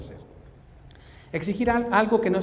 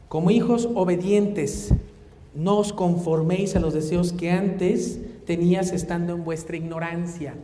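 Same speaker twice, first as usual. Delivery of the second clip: solemn scripture reading; 4 dB louder, slower than usual. Acted. Reads scripture slowly.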